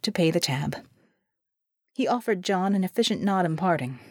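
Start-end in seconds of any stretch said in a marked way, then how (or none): none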